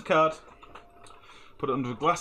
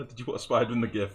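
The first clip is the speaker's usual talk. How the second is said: ordinary talk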